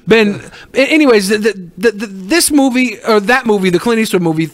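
normal talking voice

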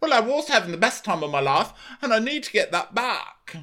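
high mock-whiny protest; put-on Tom voice. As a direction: plead in a hammed-up high voice. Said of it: "And he's like".

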